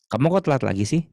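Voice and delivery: demonstrated Indonesian telling-off; scolding reproach. Shown as a demonstration. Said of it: "perasaan menegurnya tuh sama".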